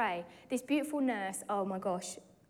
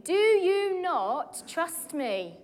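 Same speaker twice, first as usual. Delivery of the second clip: slow, gentle but higher voice of God; 6 semitones higher, 4 dB louder, much slower than usual. Retold or imitated. God gently questioning the worried mother, slow and calm. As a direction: let it land slowly and gently. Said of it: "Heavenly Father said to me".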